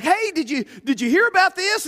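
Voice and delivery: bursting to share news; mock excited hey; eager news. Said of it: "you're like".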